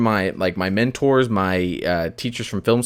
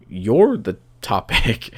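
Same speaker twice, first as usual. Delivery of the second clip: slowed right down, each word weighed; slow, deliberate answer; much slower than usual. Retold or imitated. Podcast host answering a guest's question, slow and deliberate. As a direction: spell it out slowly to a guest. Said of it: "the most succinct way I can tell them is".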